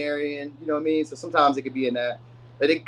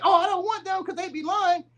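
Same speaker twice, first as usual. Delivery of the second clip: much higher, a silly put-on whine; mocking whiny voice. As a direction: imitate a fussy viewer turning a lover down. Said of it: "So don't be like".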